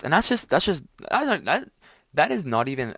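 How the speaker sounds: high, loud, stumbling protest; sputtering exasperation. Real, not acted. Ranting about what people post online, sputtering exasperation. Worked-up rant, stumbling over words.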